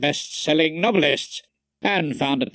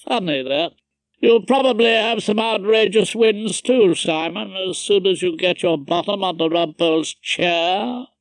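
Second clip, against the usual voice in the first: lightly voiced officious judge, higher; Justice Vosper; 5 semitones higher, 3 dB louder than usual. A narrator's reading. Judge promising his son future wins, officious. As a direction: hold forth to the son. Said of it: "The judge".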